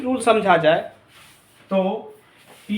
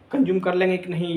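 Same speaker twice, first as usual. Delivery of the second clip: ordinary talk, nothing marked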